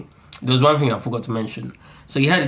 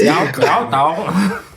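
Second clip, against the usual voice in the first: very slow Yao Tog in a put-on Chinese-sounding accent; bad Chinese accent; 3 semitones higher, much slower than usual. Shown as a demonstration. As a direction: stretch out Yao Tog in a botched foreign accent. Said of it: "that was definitely a Chinese accent".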